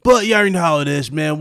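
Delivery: hyped. Real, not acted. Hyped outro to audience.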